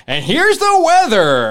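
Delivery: high, loud, slow anchor sign-off; news anchor voice. Retold or imitated. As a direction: announce it like a TV weatherman. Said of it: "And then Token comes back".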